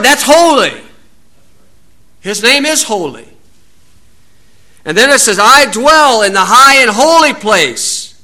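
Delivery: fiery pulpit delivery; full-force preaching. Acted. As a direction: preach it hard to the congregation.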